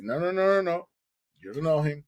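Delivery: high, emphatic no from mom; mother refusing. Retold or imitated. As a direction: shut the idea down fast. Mother refusing son's plan.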